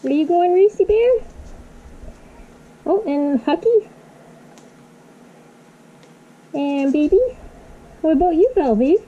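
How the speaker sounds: high sing-song coaxing to pets; pet talk. Real, not acted. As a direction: coax the little pets along sweetly. Calling guinea pigs to cross.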